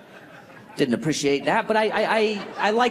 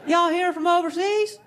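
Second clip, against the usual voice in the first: put-on Southern accent; 12 semitones higher than usual. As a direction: play the folksy Southern clerk. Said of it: "he was like".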